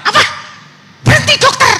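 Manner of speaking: very high, loud astonished outcry; shocked wife. Retold or imitated. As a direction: shriek it out as the disbelieving wife. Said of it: "dia bilang". (istri yang kaget menjerit tinggi)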